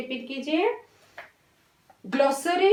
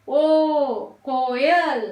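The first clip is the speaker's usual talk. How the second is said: ordinary delivery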